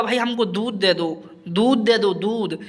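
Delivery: lightly voiced villager pleading with the everyday word "दूध"; a hint of a villager begging, trailing off on "दूध"; casual mock plea for "दूध"; mock villager asking for milk. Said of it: "ये बोलेगा".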